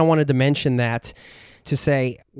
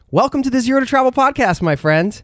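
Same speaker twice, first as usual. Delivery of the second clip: enthusiastic welcome; 12 semitones higher, 5 dB louder than usual. Real, not acted. Host welcoming guest on air.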